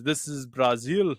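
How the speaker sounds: slow, lightly imitated growl of a tough guy; a hint of a gruff movie star; Vin Diesel impression. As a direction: drag out the line like the action hero, lightly. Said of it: "because of how he says it".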